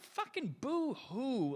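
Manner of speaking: sarcastic, slow, high mocking whine; mock boo-hoo. Mocking people whose feelings are hurt, slow sarcastic boo-hoo. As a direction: drag out the boo-hoo with scorn. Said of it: "I'm like".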